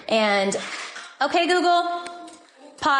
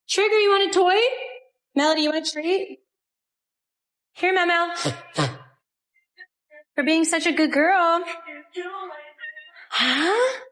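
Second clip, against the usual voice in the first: sweeten it up for the pups; pet talk; 6 semitones higher than usual. Real, not acted. Owner offering her dogs a toy and treat in a high pet voice.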